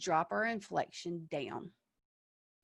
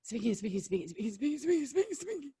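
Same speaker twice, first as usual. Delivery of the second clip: "speaking, speaking" piled on, rising higher each time; rushed nonstop talking; 5 semitones higher, faster than usual. Shown as a demonstration. Teacher mocks talking without pauses, climbing higher. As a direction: run the words together, climbing higher. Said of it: "that way we're not".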